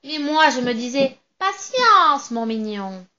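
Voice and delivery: loud, triumphant witch voice with a cackle; gloating witch. Acted. Witch gloating over the trapped narrator, loud and cackling.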